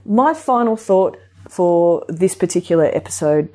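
usual speaking voice

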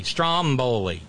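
Stromboli as STROM-bo-lee, drawn out; first-syllable stress. Shown as a demonstration. Teaching Flavio to say Stromboli, stress on STROM. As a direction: say Stromboli slowly, leaning on STROM. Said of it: "The stress is on the first syllable".

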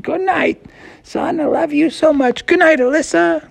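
high, sweet mom voice. Acted. Mom tucking her kids in at bedtime, high and tender. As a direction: coo it up high like a tender mother. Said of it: "And his mom said".

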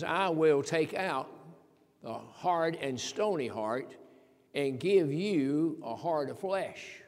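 drawn-out, weighty proclamation; slow, majestic reading of God's promise; slow solemn quote. Acted. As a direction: let each word land slowly. Quoting God's promise slowly.

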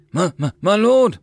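hesitant, eager underling; nervous stammer. A narrator's reading. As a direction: stammer nervously before getting the title out. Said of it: "cleared his throat and smiled weakly".

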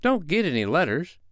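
put-on a touch of boyish scorn; contemptuous. A narrator's reading. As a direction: dismiss it with contempt. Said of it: "he said contemptuously".

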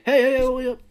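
high, loud overlapping heys; eager calling out. Acted. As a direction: clamour for his attention. Townspeople all offering their papers.

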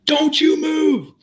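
high, loud, slow drawn-out command; commanding shout. Retold or imitated. Officer ordering the driver to freeze, loud slow shout. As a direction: bark it down the barrel, slow and huge.

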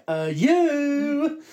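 high, drawn-out "you"; hammed-up exasperated outburst; mock exasperation. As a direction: stretch the last word into a comic curse. Retold exasperated curse at cinema staff.

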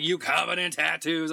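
a lightly voiced sassy nurse. The nurse eyeing the tattoos, sassy and loud. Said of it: "She's like".

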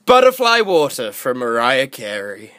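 deadpan commercial sign-off; ad tagline. Acted. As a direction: close the ad like a slogan.

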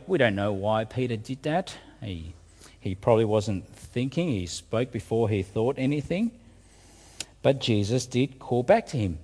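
ordinary delivery

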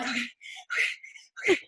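scared whisper to keep calm. Retold or imitated. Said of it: "I'm like".

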